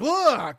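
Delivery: high, pretend "ugh" of disgust; hammed-up gag, as if spitting out food; mock spitting-out retch. Imagined taster spitting out the cake, mock retch. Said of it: "I'd literally spit it out".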